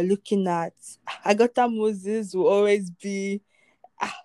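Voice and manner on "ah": normal talking voice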